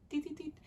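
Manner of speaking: soft, high little "deet" blips; quiet, playful high beeps for each dab; high dabbing noise. Shown as a demonstration. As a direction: make tiny high-pitched dabbing sounds. Mimes dabbing highlighter on.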